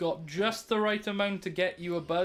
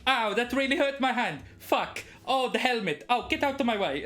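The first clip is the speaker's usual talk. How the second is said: pained whining noble; 6 semitones higher, 3 dB louder than usual. Acted. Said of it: "And then he".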